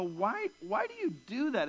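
disbelieving plea to the dog; baffled question. Retold or imitated. Owner baffled by his dog's habit, in mock incredulity.

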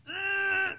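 put-on high, strained little-boy grunt; child's straining grunt. Preacher imitating the boy straining in the living room. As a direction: grunt like a kid straining hard.

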